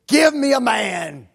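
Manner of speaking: loud, slow bellowed challenge; giant's challenge yell. Retold or imitated. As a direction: bellow it slowly across the battlefield. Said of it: "And he just screams it".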